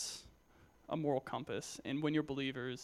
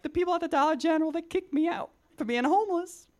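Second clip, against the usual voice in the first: much higher, louder put-on voice; homeless man's voice; 13 semitones higher, 6 dB louder than usual. Youth speaker imitates homeless man's greeting, high put-on voice. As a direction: pitch way up like the homeless guy greeting you. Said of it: "That's actually what he sounded like".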